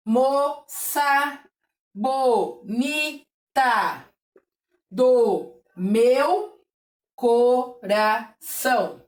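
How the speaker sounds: slow rhyme, each syllable drawn out. Shown as a demonstration. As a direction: count the mouth openings slowly. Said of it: "Boquinha de sílabas".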